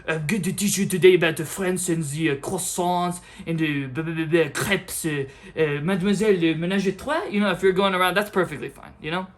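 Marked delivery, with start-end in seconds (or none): 0.1-7.2 louder, hammed-up French accent; mock French accent. French teacher greeting the class, mock French accent. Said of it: "you're a French teacher, and you're like".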